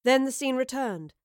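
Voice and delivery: ordinary delivery, nothing marked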